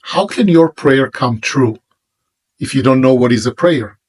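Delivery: ordinary talk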